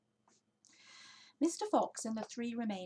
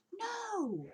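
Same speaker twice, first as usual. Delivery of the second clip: high squeak of disbelief; astonished; 3 dB louder than usual. A narrator's reading. Badger amazed at the chicken house, high astonished cry. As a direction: gasp out no.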